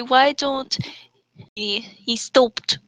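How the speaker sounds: ordinary delivery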